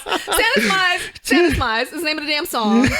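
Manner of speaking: high, loud and half-laughing tirade; comic exasperated rant. Real, not acted. Explaining the messy startup behind her song, comically exasperated. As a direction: play up the exasperation, laughter breaking in.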